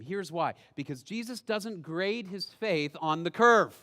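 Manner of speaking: usual speaking voice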